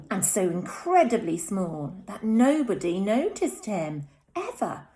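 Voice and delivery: put-on lively read-aloud to young children; animated storytime voice; story narration. A narrator's reading. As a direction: read it like a bedtime story for little ones. Teacher reads picture book aloud.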